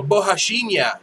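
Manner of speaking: Bohashinya said as bo-ha-SHEEN-ya; phonetic pronunciation. Shown as a demonstration. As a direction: Bohashinya ending in a clear nya. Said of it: "that's how they should spell it".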